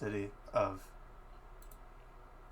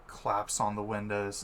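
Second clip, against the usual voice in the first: ordinary delivery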